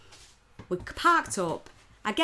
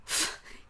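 exasperated sigh; 5 dB quieter than usual. Retold or imitated. Imitating farmer's exasperation.

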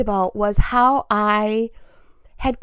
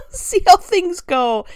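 laughing; 6 semitones higher, 4 dB louder, slower than usual. Real, not acted. Laughs through her own remark.

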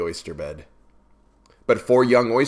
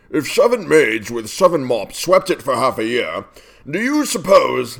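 higher, animated walrus voice; walrus character; 3 semitones higher, 3 dB louder than usual. A narrator's reading. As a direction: put the question as a theatrical walrus. Walrus speaks in a poem.

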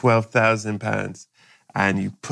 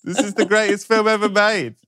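mock angry shout; 11 semitones higher than usual. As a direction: yell at the heckler in the cinema. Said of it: "Everyone's going".

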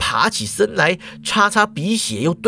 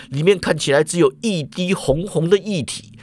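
usual speaking voice

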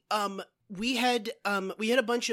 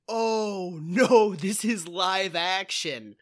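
loud, drawn-out mock dismay; retold dismay; 5 dB louder than usual. Viewer reacting to finding live action, exaggerated dismay. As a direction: groan out the letdown, louder on each "oh".

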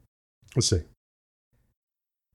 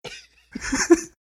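whispered mail reading; ASMR whisper; 4 dB quieter than usual. Pretend. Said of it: "whole show like that".

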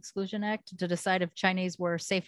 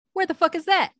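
incredulous outburst; 11 semitones higher, 5 dB louder than usual. Retold or imitated. Retelling learning ancestry was Gabon.

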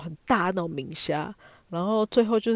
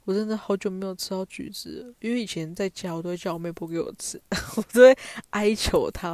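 usual speaking voice

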